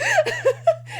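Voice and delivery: squeaky burst of laughter; high giggle. Real, not acted. Fan giggling at the prisoner wanting a break.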